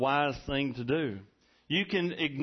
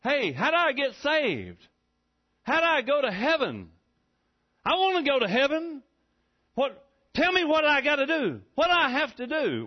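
country talk; 10 semitones higher, 5 dB louder than usual. Acted. Preacher voicing a seeker in country language, high and pleading. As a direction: beg like a plain country fella wanting heaven. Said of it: "phrase that in regular country language".

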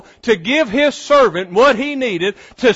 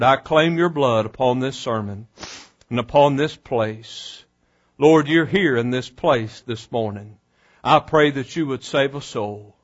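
hushed, lower prayer voice; 9 semitones lower, 5 dB quieter than usual. Real, not acted. Preacher praying over the sermon, soft and lower. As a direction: pray softly and humbly before the sermon.

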